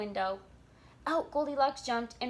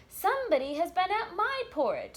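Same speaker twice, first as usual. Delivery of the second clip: higher and louder mama bear; lightly voiced mother bear, raised; middle-sized bear voice; 8 semitones higher, 6 dB louder than usual. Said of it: "in her middle-sized voice".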